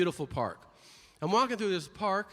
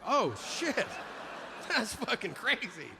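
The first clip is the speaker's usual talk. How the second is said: shocked reporter; 6 semitones higher than usual. Acted. Reporter's shocked reaction acted.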